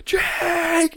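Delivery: high, stretched call of the name; imitating a yell. Retelling how Eric yelled his name from shore, high mock yell. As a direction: play the panicked friend calling out. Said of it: "is just yelling my name".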